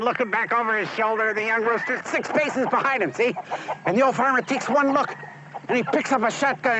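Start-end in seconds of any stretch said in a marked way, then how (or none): none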